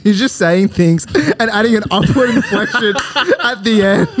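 talking through laughter; giggling through the words; laughing. Real, not acted. Mocking upward inflection, laughing.

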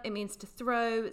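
normal talking voice

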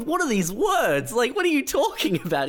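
high baffled replies to an odd caller. Retold or imitated. Baffled retort on phone.

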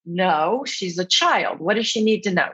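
usual speaking voice

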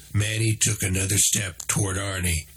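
ordinary delivery

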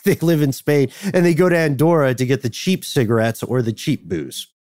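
ordinary talk, nothing marked

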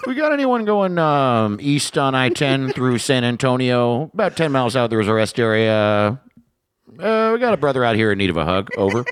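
high, laughing trucker radio call. Pretend. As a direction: sign off like a trucker. CB call ending with "Over.

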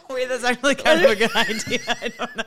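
words through real laughter; laughing. Real, not acted. Host reacts to co-host's accent idea, laughing. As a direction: talk while cracking up.

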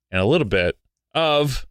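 ordinary delivery

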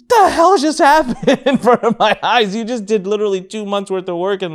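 laughing through the words; amazed and cracking up; laughing in amazed disbelief. Real, not acted.

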